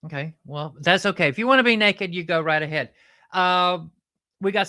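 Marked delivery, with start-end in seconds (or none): none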